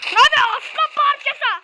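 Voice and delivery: high, loud hype shout; hyped gamer shout; excited shout. Real, not acted. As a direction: cheer a teammate on loudly. Excited call during fight.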